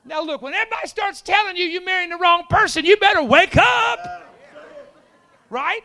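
high preaching shout, building to a yell; shouted exhortation. Real, not acted. Preacher warning congregation about marrying wrong person, shouted exhortation. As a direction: build to a full shout, stretching the final words.

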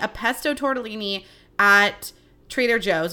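usual speaking voice

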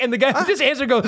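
normal talking voice

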